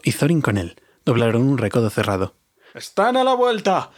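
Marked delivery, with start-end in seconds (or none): 2.7-3.9 much higher, slow urgent call; wizard shouting. A narrator's reading. Wizard warns the dwarves, high urgent shout. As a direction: cry out the warning, pitched high. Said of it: "gritó el mago".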